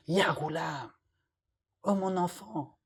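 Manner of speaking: put-on a quiet loving call to the child; gentle. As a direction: soothe a little boy. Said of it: "il fait avec compassion".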